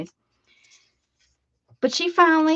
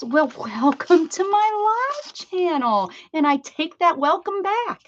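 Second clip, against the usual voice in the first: high, slow, teasing welcome then taking it back; playfully withdraw the greeting; mock welcome; 8 semitones higher, 3 dB louder than usual. Teasing a chatter who fibbed, mock-offended high welcome.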